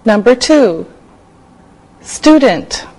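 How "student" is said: normal talking voice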